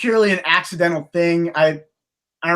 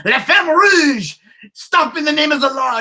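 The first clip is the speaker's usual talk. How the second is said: mock French cartoon cry, pitched way up; French accent; 11 semitones higher, 4 dB louder than usual. Imitating a Carmen Sandiego character, in a French accent. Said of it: "actually has a French accent".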